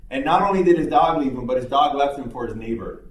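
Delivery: usual speaking voice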